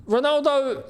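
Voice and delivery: ordinary delivery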